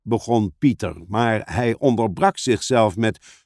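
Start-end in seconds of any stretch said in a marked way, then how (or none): none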